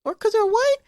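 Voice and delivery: mock squeaky disbelief; incredulous squeak. Wondering about local racism, high mock disbelief. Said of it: "And I'm like".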